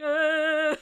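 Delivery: high, loud drawn-out "uh"; mock wail breaking into laughter; imitated pained whine. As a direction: act out a needle-prick whimper. Reaction to needle prick.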